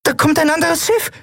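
very high, squeaky little voice; excited lookout. A narrator's reading. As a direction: pipe up with an urgent sighting. Nelen reports an approaching ship. (aufgeregt, mit ganz hoher Stimme gemeldet)

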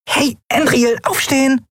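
very high little squeak; piping tiny voice. A narrator's reading. Tiny Nelen wakes Endriel with a high piping call. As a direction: pipe up like a tiny winged creature. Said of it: "piepste eine Stimme".

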